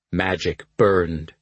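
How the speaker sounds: ordinary delivery, nothing marked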